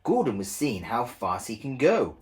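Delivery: plain reading voice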